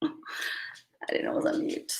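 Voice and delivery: breathy giggle, barely voiced, slowly; laughing whisper. Real, not acted. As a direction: whisper it through a laugh at yourself. Giggling at own mute mistake.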